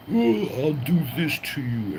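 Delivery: high squeaky mock of a rival's threat; mocking quote. Streamer mocking a rival's empty threat, high mocking voice. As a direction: parody him in a high silly voice. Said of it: "He was like".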